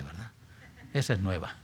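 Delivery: ordinary talk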